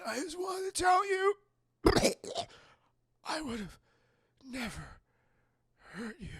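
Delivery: mock dying words. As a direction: fade out with each word. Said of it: "he's like".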